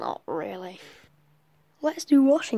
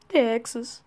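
ordinary talk, nothing marked

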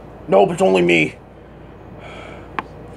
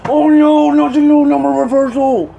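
dismayed shout; 10 semitones higher, 4 dB louder than usual. Real, not acted. Dismayed at the dice.

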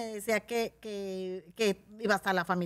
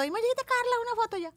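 much higher, squealing fan calling for a photo; excited fan; 4 dB louder than usual. Retold or imitated. Fan spotting her while eating, excited high squeal. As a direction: call out like a thrilled fan spotting a star. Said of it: "estaba comiendo".

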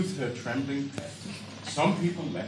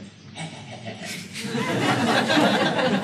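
laugh; 7 semitones higher, 4 dB louder than usual. Acted. Comedian voices the dog's laugh.

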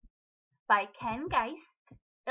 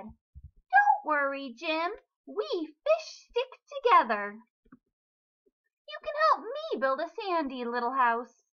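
higher, brighter little brother fish; 5 semitones higher, 5 dB louder than usual. A narrator's reading. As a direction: reassure him like a little fish. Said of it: "Tim said".

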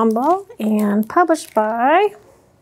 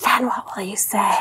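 whispered question; 6 semitones lower, 6 dB quieter than usual. Acted. Suspense before pizza snake.

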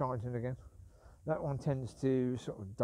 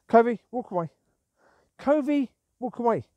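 loud, much higher call; calling the dog; 7 semitones higher, 8 dB louder than usual. Real, not acted. Owner calling Covey away, loud urgent call.

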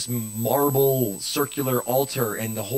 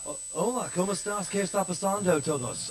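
a lightly voiced Spanish TV host, higher and softer; a hint of a Latin talk-show persona; 6 semitones higher, 6 dB quieter than usual. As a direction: greet the audience like a late-night Spanish host. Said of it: "that's my, uh, Spanish show".

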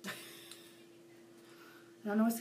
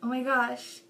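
normal talking voice

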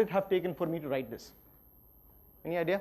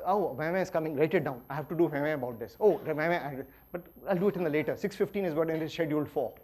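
muttered inner notes; 5 semitones lower, 7 dB quieter than usual. Retold or imitated. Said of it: "which basically says".

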